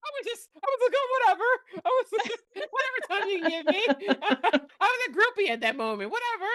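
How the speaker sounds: high giggling through the words; laughing. Real, not acted. To her friend, recalling being a groupie, laughing. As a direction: laugh at her own eagerness.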